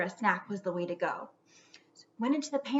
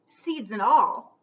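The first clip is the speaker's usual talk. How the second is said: ordinary delivery, nothing marked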